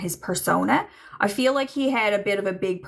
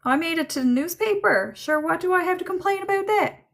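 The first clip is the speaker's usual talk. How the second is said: higher-pitched voice relaying a joking dad; William joking; 9 semitones higher than usual. Retold or imitated. Medium relays dead father making light of his death, joking, high-pitched. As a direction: lift the pitch right up for his cheeky reply. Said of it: "And he says".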